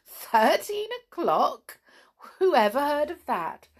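high, loud, disbelieving question; incredulous. A narrator's reading. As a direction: scoff playfully at the very idea. Mother amused and incredulous at Sandy.

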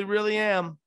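ordinary talk

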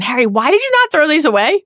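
high, incredulous scolding; exasperated. Pretend. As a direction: scold Harry in disbelief. Exasperated reader voice.

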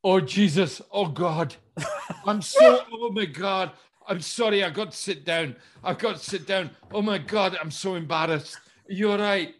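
high, loud embarrassed gushing; flustered apology. Retold or imitated. Actor apologizing after roughing up the director, high flustered gushing. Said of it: "dear George just went".

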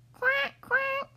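high, loud squeaky quacking; duck quack. Acted. Reading to kids, voicing the mother duck's loud high quack.

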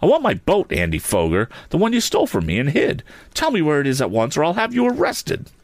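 high-pitched, heated accusation; indignant, voice climbing high; angry demand. A narrator's reading. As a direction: demand it back with a threat. Tom demands his stolen boat back.

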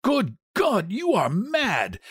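high, horrified cry; aghast. A narrator's reading. Husband hears his wife's mad plan, aghast. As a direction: drag out the words in horror.

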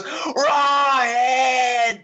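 high, drawn-out hammed-up cry; mock overacted scream. Imitating Declan running at the screen, mock scream. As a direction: wail it out like a terrible overactor. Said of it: "runs at the screen and goes".

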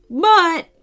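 very high, loud sputter; flustered protest. A narrator's reading. Grandpa told his check bounced, flustered protest. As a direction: stammer it in a squeaky put-on pitch.

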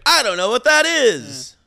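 loud, slow, baffled; confused victim. Retold or imitated. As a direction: blurt it out in confusion. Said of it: "he's like".

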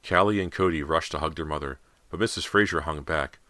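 ordinary delivery

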